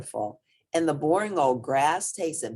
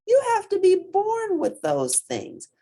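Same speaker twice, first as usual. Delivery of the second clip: elephant voice; 5 dB louder than usual. A narrator's reading. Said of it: "the elephant had to smile".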